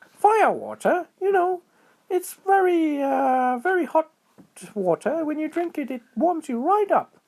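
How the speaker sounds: very high, excited little squirrel voice; high squeaky critter voice. A narrator's reading. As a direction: chirp it out like a tiny squirrel. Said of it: "said Squirrel".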